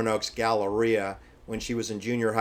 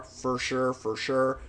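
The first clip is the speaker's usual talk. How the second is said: put-on valley girl; 2 semitones higher, slower than usual. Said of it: "expressions on the song that [name] sings".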